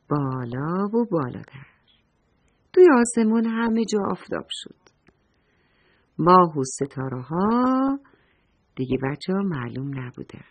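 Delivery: ordinary delivery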